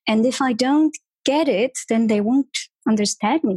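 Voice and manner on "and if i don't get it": normal talking voice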